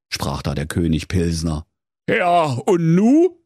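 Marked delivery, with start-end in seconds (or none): 2.1-3.4 slow, drawn-out question; stretched Berlin plea; helpless king. A narrator's reading. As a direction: ask it long and rising, at a loss. Helpless king asks what next.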